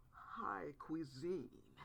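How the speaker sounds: ordinary delivery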